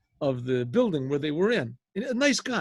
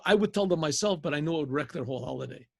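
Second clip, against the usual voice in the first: plain reading voice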